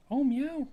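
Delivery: high playful meow; imitated meow. Shown as a demonstration. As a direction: meow back at her, high. Meowing at the cat.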